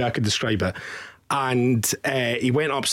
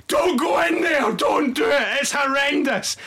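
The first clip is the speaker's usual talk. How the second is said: panicked shouting; 12 semitones higher than usual. Retold or imitated. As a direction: shout a frantic warning to stay out. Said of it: "people running out of the toilet, going".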